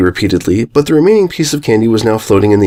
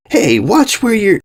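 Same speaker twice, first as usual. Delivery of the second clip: startled snap; 11 semitones higher, slower than usual. Acted. Pony bumped from behind, cut off.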